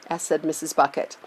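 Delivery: usual speaking voice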